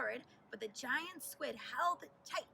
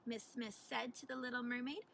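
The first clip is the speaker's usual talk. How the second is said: ordinary delivery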